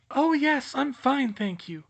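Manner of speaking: put-on high, airy dreamy girl. Voices Luna.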